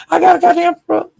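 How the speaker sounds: high, confident Kendra impression. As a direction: pitch up to play Kendra sure of herself. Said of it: "Kendra said".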